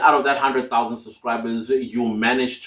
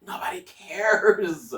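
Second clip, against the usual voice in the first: whispered; 6 semitones higher, 3 dB quieter, slower than usual. Shown as a demonstration. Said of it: "don't worry about your pronunciation".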